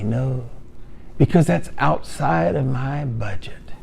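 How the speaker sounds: soft near-whispered no; hushed refusal. Acted. Buyer quietly refusing the salesman, near whisper. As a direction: tell him under your breath it's too much.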